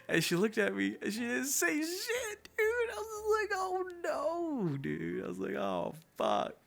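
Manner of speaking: very high, loud squeal of amusement; cracking up. Real, not acted. Storyteller recalling her silence, real high squealing laughter. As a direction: squeal it through helpless laughter.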